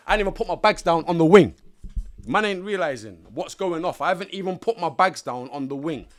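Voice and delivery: loud, emphatic retelling; emphatic amazed retelling; stunned. Real, not acted. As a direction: still shocked it happened first day. Stunned at stabbing on day one.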